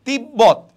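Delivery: তিব্বত loud, as tib-bot; b fully sounded. Shown as a demonstration. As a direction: hold the double b in তিব্বত. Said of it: "বয়ের উচ্চারণ বজায় থাকতেছে".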